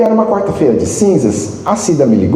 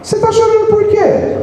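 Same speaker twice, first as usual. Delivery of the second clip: mocking busybody. Mocking spiritist in wake.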